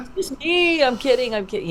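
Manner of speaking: very high, laughing reassurance; playful backpedalling. Acted. Prankster owning up to a scared friend, giggly and high. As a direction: squeal it through a laugh, then come down.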